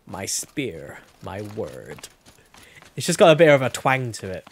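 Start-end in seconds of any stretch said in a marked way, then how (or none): 0.1-2.1 slow, lightly drawn-out imitation of Sona; a hint of Sona's slow, deliberate voice; imitating Sona. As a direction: mimic her measured warrior delivery, slowed. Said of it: "Just kind of talks like this".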